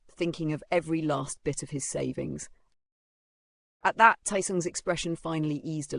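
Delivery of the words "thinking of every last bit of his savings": usual speaking voice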